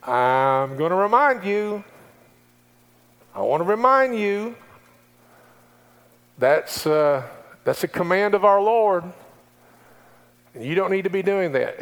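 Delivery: lightly voiced, patient divine reminder; preacher voicing the Holy Spirit's gentle warning. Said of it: "you hear the Holy Spirit going".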